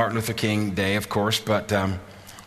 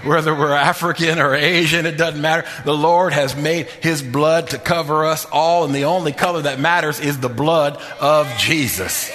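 higher and louder, rhythmic preacher's call; full preaching; 6 semitones higher, 3 dB louder than usual. Acted. Pastor rallying his brothers and sisters on unity, impassioned preaching. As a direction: preach it with rising fervor over the music.

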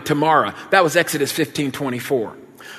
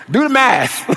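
put-on exasperated kid; 8 semitones higher, 3 dB louder than usual. Kids' acted protest continues.

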